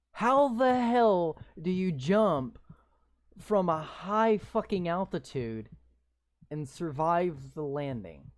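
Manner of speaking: loud mocking disbelief; incredulous outburst. Real, not acted. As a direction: drag it out like you can't believe what you read. Mocking the game's story.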